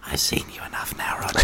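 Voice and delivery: unvoiced hush; a hushed mock whisper; whispered aside. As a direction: whisper it out of his earshot, weary of him. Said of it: "there is a time when you'd go".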